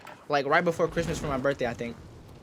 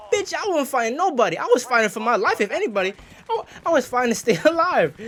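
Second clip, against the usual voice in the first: high-pitched, exasperated comeback; retold outraged defense, half amused; indignant protest; 7 semitones higher, 4 dB louder than usual. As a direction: protest a false accusation, pitch jumping up. Indignant retort to fighting accusation.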